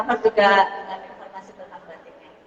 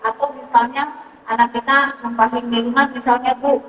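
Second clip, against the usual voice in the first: ordinary delivery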